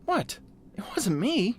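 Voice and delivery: put-on high-pitched boy's protest. A narrator's reading. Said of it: "he tried to explain".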